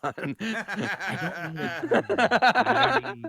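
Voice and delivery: laughing denial. Real, not acted. Tipsy friend laughing off a crude joke with a high laugh. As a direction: laugh it off slowly.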